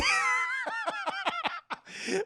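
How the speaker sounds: very high shrieking laugh; loud laugh. Real, not acted. Streamer cracking up at the villain's line. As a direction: burst out cackling.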